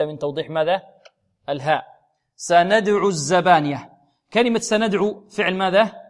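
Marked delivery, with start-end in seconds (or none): 2.4-3.9 slow recitation; تلاوة مجودة. Shown as a demonstration. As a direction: recite the verse slowly and evenly. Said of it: "يتلو الآية". (تلاوة بطيئة)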